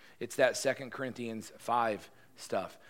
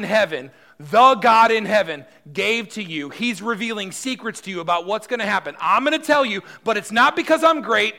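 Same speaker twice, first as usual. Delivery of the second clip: bold proclamation; 7 semitones higher, 12 dB louder than usual. Acted. Daniel boldly proclaiming to king.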